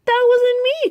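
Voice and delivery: high, loud protest; frightened cry. Acted. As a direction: squeal it in panic. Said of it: "cried Rex".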